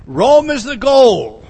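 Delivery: loud, slow declaration; emphatic proclamation. Real, not acted. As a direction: proclaim the goal like a rallying cry. Preacher proclaims goal to congregation.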